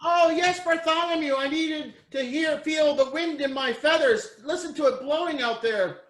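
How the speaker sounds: high, dreamy bird voice, savoring the wind; a lofty bird enjoying the breeze; pet bird voice. Acted.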